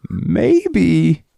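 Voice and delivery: high, drawn-out timid maybe; a nervous, unsure offer; mock timid politician; hesitant government. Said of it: "the American government went".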